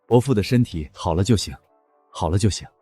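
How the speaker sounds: low, soft and relieved; quiet relief. Acted. Hearing the patient recovered, quietly relieved. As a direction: murmur it to yourself, low. Relieved at good news, murmured.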